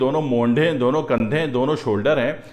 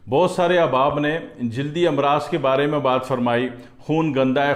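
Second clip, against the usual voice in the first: usual speaking voice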